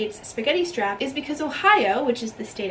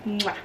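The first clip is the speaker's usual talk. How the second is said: kiss sound; 3 semitones lower than usual. Real, not acted. Blowing a kiss goodbye.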